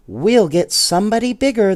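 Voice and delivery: high, loud, quick doll voices; eager dolls reassuring the bear; dolls promising. A narrator's reading. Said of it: "the dolls promised".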